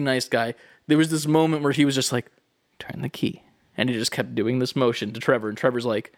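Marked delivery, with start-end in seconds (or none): 2.8-3.4 whispered, soft instruction; hushed urging. Retold or imitated. Venue owner to Trevor, a whispered urge. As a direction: breathe it under your breath.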